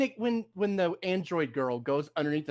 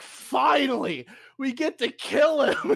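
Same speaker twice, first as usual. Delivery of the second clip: higher, drawn-out mock relief; mock eager relief; 8 semitones higher, 4 dB louder, slower than usual. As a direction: exult as if finally allowed, half laughing. Retelling relieved, eager characters' reaction.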